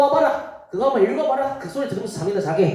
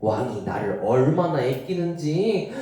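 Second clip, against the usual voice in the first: ordinary talk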